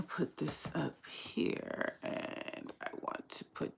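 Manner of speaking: soft whispered aside; whispering. Real, not acted. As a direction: whisper to yourself. Muttering to herself setting up.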